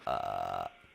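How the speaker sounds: very low, soft rattling fry with no tone; just the creaky rattle, no voice; voiceless vocal fry. Shown as a demonstration. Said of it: "ビリビリビリっていう音だけ出る".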